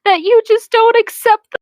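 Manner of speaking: high, whiny mock sob in a girlish voice; hammed-up girlish whimper; mock sobbing girl. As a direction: ham up a weepy girl pleading. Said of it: "Hermione sobbed femininely".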